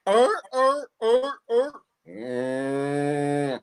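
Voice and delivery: high squealing Australian farm animal grunts; farmyard animal noises. Pretend. Comic for the Melbourne host, high Australian farm animal noises. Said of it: "let's go through the farmyard".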